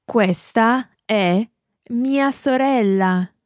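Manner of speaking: ordinary talk, nothing marked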